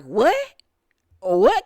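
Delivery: loud, short imitation of not hearing; retold "what?". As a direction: throw it out loudly like you can't hear. Jocelyn cannot hear the girl.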